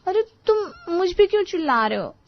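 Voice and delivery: high-pitched, hurt objection; indignant protest. Acted. Wife objects to husband yelling at her, indignant. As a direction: protest at being yelled at.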